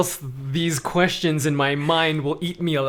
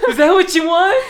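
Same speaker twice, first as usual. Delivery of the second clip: much higher, louder laughing retort; a much higher, louder laughing retort to a nosy asker; mock exasperation; 8 dB louder than usual. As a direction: toss it back, giggling and loud. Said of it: "What do you want me to say".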